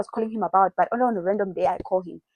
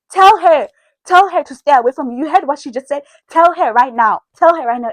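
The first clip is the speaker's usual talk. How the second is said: higher, louder insistent commands; mimicked demanding girlfriend; 5 semitones higher, 6 dB louder than usual. Girlfriend ordering the boyfriend, higher and insistent. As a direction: push him hard to obey.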